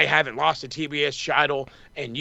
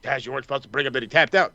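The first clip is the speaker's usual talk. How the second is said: mock scrambling commentator; 4 semitones lower, 3 dB quieter, much faster than usual. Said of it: "scrambling on commentary like".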